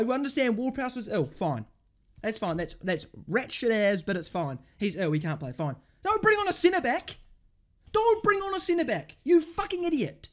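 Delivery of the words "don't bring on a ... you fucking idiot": high, heated berating; real exasperated anger. Real, not acted. Fan berating the manager over a substitution, angry and high. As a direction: scold an idiot in a raised pitch.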